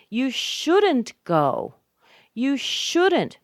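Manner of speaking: "shouldn't" high, loud and drawn out; stress on SHOULDN'T. Shown as a demonstration. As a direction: punch "shouldn't" with heavy emphasis. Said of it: "I'm really emphasizing the word shouldn't".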